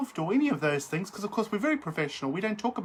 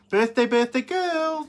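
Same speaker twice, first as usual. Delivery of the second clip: birthday cheer; 7 semitones higher, 6 dB louder than usual. Real, not acted. Sing-song birthday greeting.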